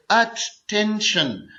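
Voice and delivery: Attention very slowly, अ-टें-शन; syllable by syllable. Shown as a demonstration. Teacher demonstrating a three-syllable word, attention slowly split in three. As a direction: pull attention apart into three beats. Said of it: "ये three syllable word है".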